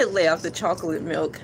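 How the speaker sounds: ordinary delivery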